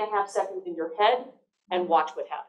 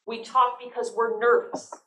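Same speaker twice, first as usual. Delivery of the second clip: ordinary talk, nothing marked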